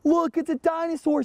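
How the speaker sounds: high-pitched, excited call; excited child's shout. Acted. Jimmy spotting the dinosaur skeleton, high excited call. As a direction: point it out like an amazed schoolboy, high. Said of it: "he shouted".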